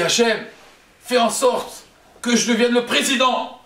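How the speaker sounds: high-pitched, slowing, emphatic prayer; fervent plea. Acted. Rich man asking Hachem for power, fervent plea. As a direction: implore heaven, drawing out the request.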